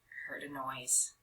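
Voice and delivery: ordinary delivery, nothing marked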